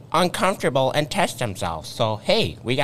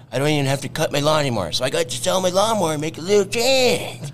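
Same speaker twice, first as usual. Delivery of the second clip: a guy giving a happy product review; Dave. Acted. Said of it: "we got this guy over here named Dave".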